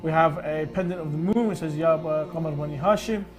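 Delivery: ordinary talk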